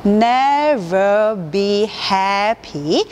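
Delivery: never be happy stretched very slowly, syllable by syllable; never be happy drawn out in separate sounds; syllable-split demo. Shown as a demonstration. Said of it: "한 글자 포닉스를 보시면".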